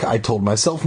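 ordinary delivery

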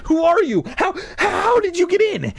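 high stammering panic; terrified. Acted. Brother to the intruder, terrified. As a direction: squeal it in fright. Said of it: "his expression terrified".

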